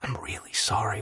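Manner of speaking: soft whispered apology; whispering. A narrator's reading. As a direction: whisper it close to his ear. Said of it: "he whispered as they moved away".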